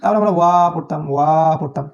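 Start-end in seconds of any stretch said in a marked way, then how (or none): 0.4-0.5 ওয়া with a long "aa"; drawn-out vowel. Shown as a demonstration. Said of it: "আমরা ঠিকই টানতাম". 1.1-1.6 ওয়া with its "aa" held long; drawn-out vowel. Shown as a demonstration. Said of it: "আমরা ঠিকই টানতাম".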